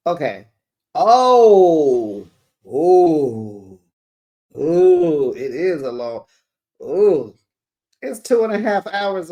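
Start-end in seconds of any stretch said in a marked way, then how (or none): 0.9-7.3 loud, drawn-out oohs; real surprise. Real, not acted. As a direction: react aloud to an unexpected finding. Sees how long the drive is.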